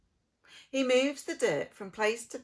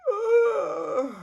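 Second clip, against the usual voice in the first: weary groan; 10 semitones higher, 8 dB louder than usual. Acted. Weary cement mixer groans.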